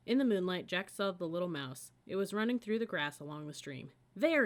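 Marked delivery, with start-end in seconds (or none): 4.2-4.5 high, loud cry; cried out. A narrator's reading. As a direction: call out urgently to Annie. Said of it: "he cried".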